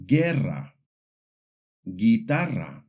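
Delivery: guerra and guitarra with hard g, no w sound; Spanish guerra, guitarra, U left silent. Shown as a demonstration. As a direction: say guerra as GEH-rra, guitarra as gee-TA-rra. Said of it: "the U is silent".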